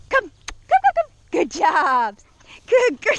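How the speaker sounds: high, loud, urging and praising; excited recall praise. Shown as a demonstration. Trainer exciting Daisy on recall, high and loud. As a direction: hype the dog up as it runs in. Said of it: "I want her to be excited".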